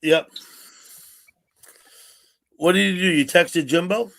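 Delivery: normal talking voice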